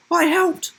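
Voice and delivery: high, loud, proud; proud little brother. Acted. Little brother boasts, high loud pride. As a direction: say it proudly like a small kid. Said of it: "said his brother proudly".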